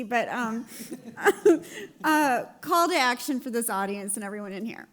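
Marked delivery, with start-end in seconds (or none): 0.7-2.0 loud high laugh; burst of laughter. Real, not acted. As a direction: laugh out through emotion. Emotional moment, laughing it off.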